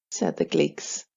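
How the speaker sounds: ordinary delivery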